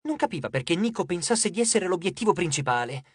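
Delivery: ordinary delivery, nothing marked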